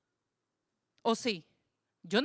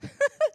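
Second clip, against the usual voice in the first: short laugh; 7 dB louder than usual. Real, not acted. Congregation joke.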